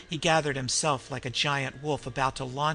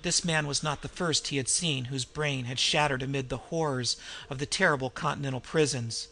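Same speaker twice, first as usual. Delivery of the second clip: ordinary delivery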